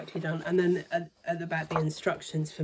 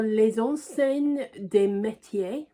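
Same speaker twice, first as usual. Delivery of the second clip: French accent; 5 semitones higher, 7 dB louder, slower than usual. Real, not acted. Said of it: "about as good as my French accent can get".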